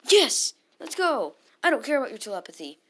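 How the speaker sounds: loud high cheer, rushing fast; victory cheer. Real, not acted. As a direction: shout it out in triumph. Wins the exchange.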